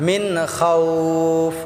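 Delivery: খাউফ drawn out for three beats; long elongation. Shown as a demonstration. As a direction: stretch the vowel in খাউফ, no hum on মিন. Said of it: "তিন আলিফ টেনে পড়ব". (খাউফ লম্বা করে টেনে)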